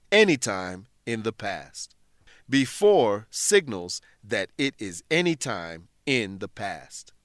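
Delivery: ordinary delivery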